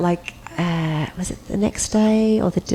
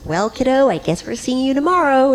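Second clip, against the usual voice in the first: Muppet-like voice; 7 semitones higher than usual. Retold or imitated. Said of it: "he sounds like a Muppet".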